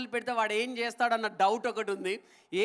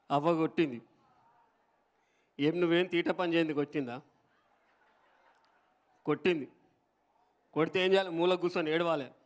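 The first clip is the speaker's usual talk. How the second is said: lower-pitched Telangana politician's voice, teasing; KCR impression; 4 semitones lower than usual. Comic voicing KCR lecturing the little parrot, low and teasing. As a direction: scold the parrot like a folksy leader at a rally. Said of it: "మన కేసీఆర్ గారు చెప్తే ఈ విధంగా ఉంటుంది".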